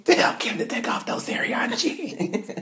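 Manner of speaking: slow breathy whisper; whispered plea. Retold or imitated. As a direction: whisper it urgently. Child whispering to [name].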